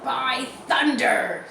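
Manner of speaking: gruff pirate awe, drawn out; Long John exclaiming. Acted. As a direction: bellow it like a startled old sea dog. Pirate captain exclaims at skeleton.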